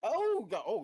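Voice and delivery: startled high yelp of fright; jump scared. Real, not acted. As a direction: caught off guard by a jump scare. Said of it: "jump scared me with that".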